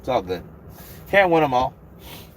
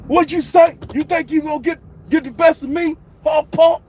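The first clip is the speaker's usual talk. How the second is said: put-on much higher, louder shouting old trainer voice; Floyd Senior impression; 6 dB louder than usual. Doing Floyd Senior for the stream, a high loud boastful yell. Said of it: "Oh, Floyd Senior".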